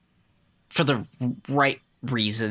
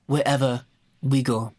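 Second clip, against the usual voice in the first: put-on African accent. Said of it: "my African accent".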